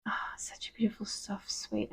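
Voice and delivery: soft whisper. Real, not acted. Describing soft angelic energy softly.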